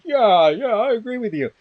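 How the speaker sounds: high, loud mock agreement; eager yes-man. Fancy committee guy backing his colleague, mimicked in a high, eager voice. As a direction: chime in high as a sycophantic panelist.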